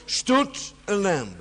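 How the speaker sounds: slow, high, loud "stood", falling to "lamb"; stress on STOOD. Shown as a demonstration. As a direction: lean hard on the first word "stood". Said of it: "emphasis is always on the first word".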